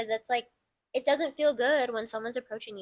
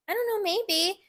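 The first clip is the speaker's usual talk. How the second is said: nonchalant brush-off; 8 semitones higher than usual. Retold or imitated. Mock-casual reply to stranger.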